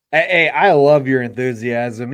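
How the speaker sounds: ordinary talk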